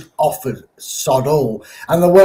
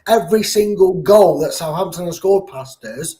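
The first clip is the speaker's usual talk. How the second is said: normal talking voice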